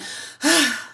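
imitated heavy sigh; big sigh. Mom imitating her son beside her, big sigh. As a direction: let out one big audible breath. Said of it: "he just did this big sigh".

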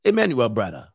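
badly faked Nigerian accent; hammed-up Nigerian "brother" talk; mock Nigerian accent. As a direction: put on a playful Nigerian accent, scolding a brother. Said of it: "This is my Nigerian accent".

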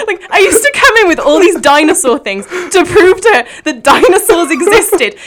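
high, loud, laughing through it. Real, not acted. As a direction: giggle through the story. Excited retelling with laughter.

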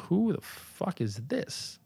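slow, very soft disbelief; quietly baffled, drawn out; hushed bafflement. Retold or imitated. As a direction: say it slowly under your breath, puzzled. Acts out reaction to unknown email.

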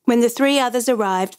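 usual speaking voice

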